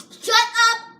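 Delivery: loud, high indignant yell; yelling shut up. Real, not acted. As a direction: shout it at your teasing sister. Yelling at teasing sibling.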